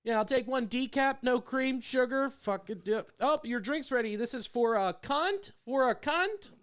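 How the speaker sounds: hint of a chirpy barista calling orders; mock barista. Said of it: "like I'm ordering something at fucking Starbucks".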